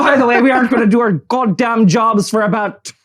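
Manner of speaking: hammed-up Irish brogue; horrible Irish accent. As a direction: greet the audience in a badly faked Irish accent.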